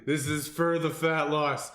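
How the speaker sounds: loud, high, strained hype of a suffering runner; hammed-up battle cry to keep going; mock self-motivation. Coach mimics runner dying by the road, mock determined shouting. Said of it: "You're like".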